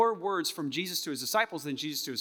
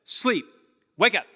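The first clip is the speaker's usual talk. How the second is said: Sleep higher, Wake up punched loud; louder mock commands; mock contradictory orders; 5 semitones higher, 9 dB louder than usual. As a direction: bark two clashing orders. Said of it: "How can you do that?".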